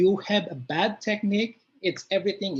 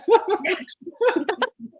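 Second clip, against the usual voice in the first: high embarrassed laugh; 13 semitones higher, 6 dB louder than usual. Real, not acted. Reacts to coach's reading.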